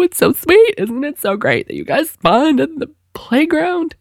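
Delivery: put-on high, choked-up gushing over the kids; fighting back happy tears, voice way up; teary gushing. Mom tearing up at kids' birthday wishes, high teary gush.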